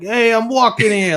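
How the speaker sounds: loud, high Brooklyn tough guy; Brooklyn accent. Retold or imitated. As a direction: bark it like a New York cabbie. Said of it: "thick Brooklyn Casey accent".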